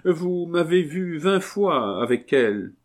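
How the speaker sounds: high-pitched plaintive reproach; doleful. Acted. Marquise reminding the abbé, high and doleful. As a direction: complain mournfully in a high voice. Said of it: "d'un ton dolent".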